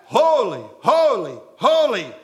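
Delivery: each "Holy" called out loud and ringing; crying out. Acted. Seraphim cry out before God's throne, loud and proclaiming. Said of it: "the seraphim angels who cry out".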